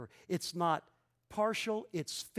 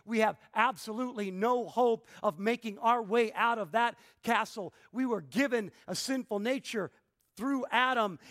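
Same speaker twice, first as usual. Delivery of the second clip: ordinary talk, nothing marked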